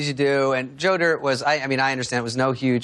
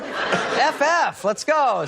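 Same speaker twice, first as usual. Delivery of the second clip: impatient cheer; 9 semitones higher, much slower than usual. Retold or imitated. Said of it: "He goes".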